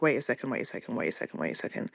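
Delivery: second pair whispered low and soft; hushed wait. Retold or imitated. Julia interrupting her in a hushed, low voice. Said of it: "Julia was like".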